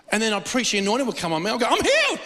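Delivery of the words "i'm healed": loud, very high triumphant cry; exultant mock shout. Preacher under the anointing declaring his cure, exultant mock shout. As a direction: burst out as if suddenly cured.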